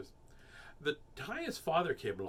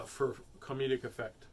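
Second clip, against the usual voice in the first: usual speaking voice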